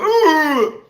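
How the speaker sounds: put-on high, loud muffled ooh of a toddler; toddler's muffled ooh. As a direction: mimic a baby's dull little ooh. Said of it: "He went".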